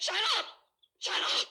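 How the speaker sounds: wife screaming at the taunting detective. Acted. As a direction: scream him down, at the breaking point. Wife breaks at his taunts.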